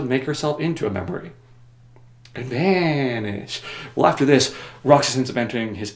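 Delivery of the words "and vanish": very slow, stretched ending; drawn-out vanish. Shown as a demonstration. Telling how a character disappears, drawn out. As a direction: let the word stretch and dissolve.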